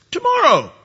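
high, haughty one-word reply; a smug king brushing off the plague; proud Pharaoh. Retold or imitated. Preacher voicing proud Pharaoh's answer, haughty and high.

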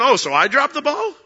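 a playful offended retort; mock indignant Lord. The Lord answering a weak prayer, mock indignant. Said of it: "the Lord's going".

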